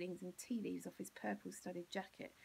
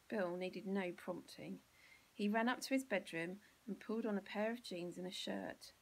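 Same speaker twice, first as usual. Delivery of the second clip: ordinary delivery, nothing marked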